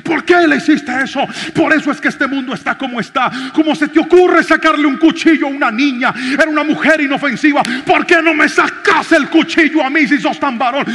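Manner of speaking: loud, high, nonstop ranting tirade; furious scolding. Retold or imitated. Pastor scolds the thief on the ground, loud furious rant. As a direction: tear into him with outrage.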